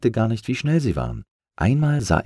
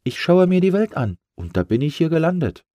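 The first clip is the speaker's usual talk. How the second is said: higher, chirpy balloon; little balloon voice; 10 semitones higher, 4 dB louder than usual. Acted. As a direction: tell the turtle about his travels. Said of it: "sagte Lufti". (hohe, fröhliche Ballonstimme)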